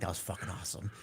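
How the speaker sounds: very soft, low imitated backstage confession; hushed aside, imitated. Voicing a wrestler secretly pleased backstage, hushed low aside. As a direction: say it privately, barely aloud.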